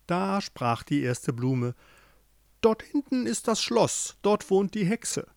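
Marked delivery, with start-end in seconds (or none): 2.6-5.3 lightly voiced flower, higher than the narration; talking flower. A narrator's reading. First flower warns the couple about the witch, lightly voiced.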